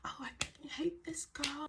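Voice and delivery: mock whisper, hushed and airy; imitated backbiting. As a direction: imitate someone whispering behind a back. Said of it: "talking to my friend behind my back".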